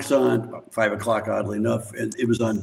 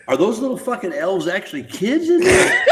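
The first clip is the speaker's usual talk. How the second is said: laughing disbelief; 8 semitones higher, 3 dB louder than usual. Real, not acted. Realizing elves are kids.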